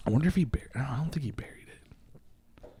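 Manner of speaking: unvoiced hushed aside; whisper. Real, not acted. Storyteller wonders aloud if the turtle was buried, whispering. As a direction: drop to a whisper as if telling a secret. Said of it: "whispered aside".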